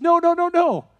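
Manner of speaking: high, loud imitation of tearful protest; rapid string of pleading refusals, acted out. Lady in tears answering the youth pastor's apology, mock tearful protest. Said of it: "in tears, she says".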